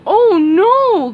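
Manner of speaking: loud dopey starfish cry; cried out. A narrator's reading. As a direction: gasp it out in dismay. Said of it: "cried Patrick".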